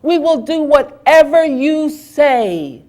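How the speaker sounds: high, loud pleading cry; desperate begging. A narrator's reading. Terrified people beg Shakra to spare their world, high loud pleading. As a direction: beg for your lives. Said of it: "the people were on the ground begging".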